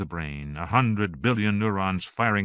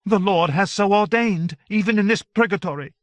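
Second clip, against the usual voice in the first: Joan; 11 semitones higher, 6 dB louder than usual. A narrator's reading. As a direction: proclaim it like scripture. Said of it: "Joan agreed".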